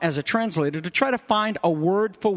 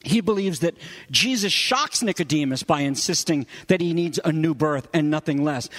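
ordinary talk, nothing marked

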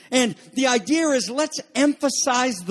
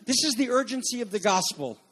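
normal talking voice

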